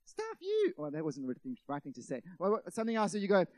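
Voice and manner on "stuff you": high-pitched mock-vindictive retort; vindictive outburst. Preacher blurting the wrong reply to God, mock-vindictive. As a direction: pipe up with a sudden high, dismissive squawk. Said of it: "I don't get vindictive and say".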